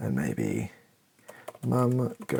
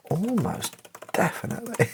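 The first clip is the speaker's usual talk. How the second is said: higher and fast, a breezy dad; quick high reply; 11 semitones higher, faster than usual. Acted. Dad answering mum about being bad parents, quick and higher.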